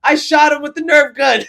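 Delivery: loud, high, no-big-deal imitation; dismissive. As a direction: toss it off like it's nothing. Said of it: "in the most, like, dismissive".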